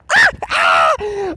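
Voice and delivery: loud burst of real laughter. Real, not acted. Reviewer cracking up at his own parody, loud laugh.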